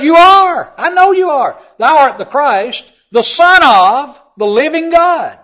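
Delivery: loud, high, slow proclamation, hammering each phrase; full-force preaching. Acted. Preacher proclaims Peter's confession to the congregation, shouting. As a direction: declare it like Peter's great confession.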